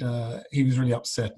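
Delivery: ordinary talk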